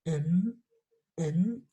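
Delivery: "N" as a voiced hum, not the letter name; isolated "N" sound, voiced; bare N sound. Shown as a demonstration. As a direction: hold "N" as "nnn" so the voice vibrates. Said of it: "el sonido de la N".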